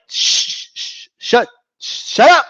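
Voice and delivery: long hiss of shushing, then a high command; exaggerated shushing. Vlogger hushes arguing fans, exaggerated shushing. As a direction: cut off an imagined heckler.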